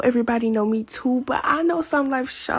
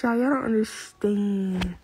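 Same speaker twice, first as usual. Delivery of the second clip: ordinary talk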